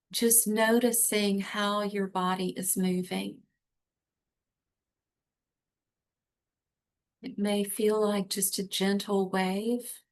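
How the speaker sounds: calm, guiding breathing coach; guided breathing. Shown as a demonstration. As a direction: gently guide them to relax. Leads a breathing exercise.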